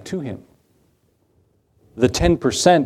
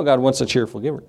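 ordinary talk, nothing marked

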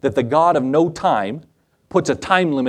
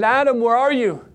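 higher-pitched searching call; 6 semitones higher than usual. Acted. God finding Adam gone from the garden, calling out. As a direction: call out for someone hiding.

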